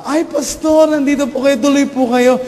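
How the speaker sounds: high, bright welcome; polite child greeting. Retold or imitated. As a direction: greet the pastor sweetly. (magalang na bati ng bata sa pastor)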